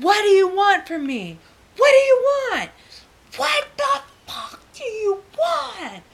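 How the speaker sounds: high-pitched, escalating angry outburst; angry demand. Retold or imitated. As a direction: demand it harder each time, drawing out the last.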